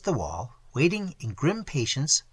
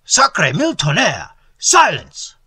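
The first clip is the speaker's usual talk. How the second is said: much louder, higher furious roar; 5 semitones higher, 9 dB louder than usual. A narrator's reading. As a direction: roar the crowd into silence. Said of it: "roared forth one of the Jacobin guards".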